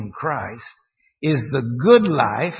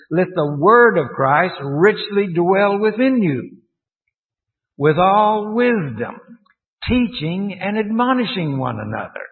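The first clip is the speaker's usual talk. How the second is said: higher, louder, slowed reading with weight on key words; emphatic scripture reading; 5 semitones higher, 4 dB louder than usual. Real, not acted. Teacher driving a verse home to the congregation, emphatic. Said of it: "I'm trying to emphasize".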